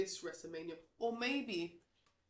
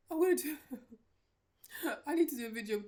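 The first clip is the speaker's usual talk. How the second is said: real laughter breaking through, higher and fast; laughing; 8 semitones higher, 6 dB louder, much faster than usual. Real, not acted. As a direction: crack up while trying to get the words out. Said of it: "I'm laughing because".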